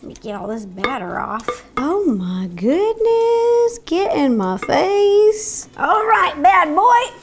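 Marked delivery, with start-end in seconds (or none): 1.8-5.7 high, drawn-out gushing; hammed-up craving. 5.8-7.1 high, loud mock challenge; playful tough talk. Playfully addressing the mixer part.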